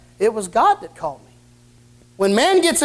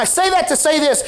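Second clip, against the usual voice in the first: raised, ringing preacher's climax; preaching at full force; 6 semitones higher than usual. Acted. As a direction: lift it up and preach it home.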